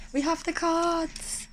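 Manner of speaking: fully voiced, high and drawn out; out of the whisper. Real, not acted. Card reader re-announcing the deck to viewers, voiced high and slow. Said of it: "I don't know why I'm whispering".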